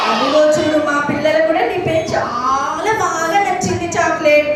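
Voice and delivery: ordinary talk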